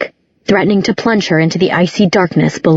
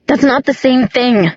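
higher, tearful protest; 6 semitones higher than usual. A narrator's reading. Daughter rejects his comparison, tearfully. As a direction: protest on the edge of tears. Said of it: "could hear the tears in her voice".

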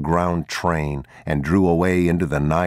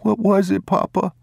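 put-on boy's voice, much higher, shaky question; a scared boy asking his father; boy asking Papa what the noise was; frightened child. A narrator's reading. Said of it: "boy frightened after earthquake".

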